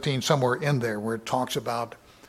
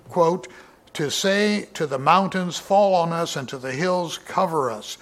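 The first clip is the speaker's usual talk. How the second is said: raised, declaiming Bible reading voice; lifted pulpit reading, a touch louder; scripture reading; 4 semitones higher, 5 dB louder than usual. Acted. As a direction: proclaim the verse to the congregation. Reading verses twenty-nine and thirty.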